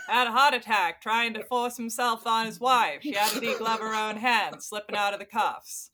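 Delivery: put-on thick New England Maine accent. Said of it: "my main accent".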